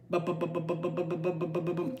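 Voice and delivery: soft, steady repeated "bu" filler; quiet motorboat-like lip patter; stalling "bu-bu-bu" noise. Real, not acted. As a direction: burble "bu-bu-bu" while searching. Stalling while looking for a myth.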